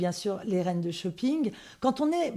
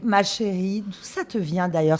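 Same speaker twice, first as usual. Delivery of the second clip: normal talking voice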